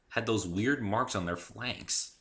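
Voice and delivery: plain reading voice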